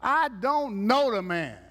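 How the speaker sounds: mock emphatic denial; denying Peter. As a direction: drag out the denial. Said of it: "he started cussing".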